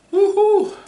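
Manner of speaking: high, loud woohoo; excited whoop. Real, not acted. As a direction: whoop out from the heat. Reaction to chili heat.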